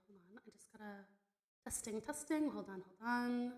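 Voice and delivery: normal talking voice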